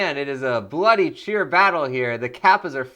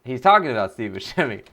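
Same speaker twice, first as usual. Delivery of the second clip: usual speaking voice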